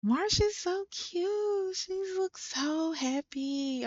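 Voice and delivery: high, slow cooing; drawn-out high-pitched adoring gush; gushing fondly. Real, not acted. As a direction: coo over someone adorable, stretching the words. Gushing over her cute Sim.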